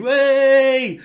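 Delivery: loud, high, drawn-out mock cheer; whooping "wey!", held long and high; shouted group cheer. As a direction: re-enact a bar full of friends cheering. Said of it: "we're all there shouting".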